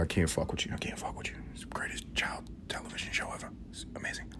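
hushed conspiratorial whisper; whispered aside. Acted. Joking aside to listeners who don't know Little Bear, whispered. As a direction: lean in and whisper it like a secret.

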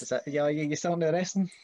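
mock nosy question. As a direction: poke fun at the cliché. Mocking the common question.

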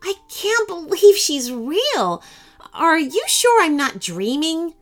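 put-on high-pitched child in wonder; a little girl who can hardly believe it; high, amazed girl's voice; young girl. A narrator's reading. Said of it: "said Rachel".

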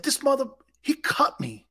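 high, stunned outburst; indignant disbelief. Retold or imitated. Player hearing he's cut, stunned and high. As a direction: spit it out in shocked disbelief. Retold outrage at being cut.

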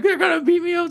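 high, whiny tearful plea; mock crying. Drunk prisoner whining to the officer, mock crying. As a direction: whimper it like a scared drunk. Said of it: "he's like".

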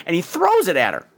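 ordinary talk, nothing marked

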